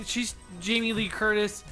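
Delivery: normal talking voice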